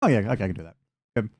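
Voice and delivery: ordinary talk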